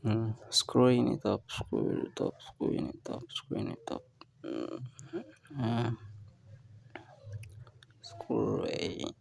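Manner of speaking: faint, barely voiced, off mic; whispered to himself mid-task; far from mic. Real, not acted. Working away from the microphone.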